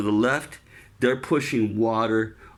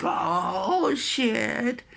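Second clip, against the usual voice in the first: higher, startled mock exclamation; oh shit moment; 12 semitones higher than usual. Injured sailor realizing his arm hangs, startled. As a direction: blurt it out like a sudden realization. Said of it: "I'm like".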